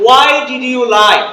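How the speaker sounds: "Why did you lie" slowly, each word full; slow learner pronunciation. Shown as a demonstration. Teacher repeats for students, slow loud "Why did you lie". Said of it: "আমরা বলি যে".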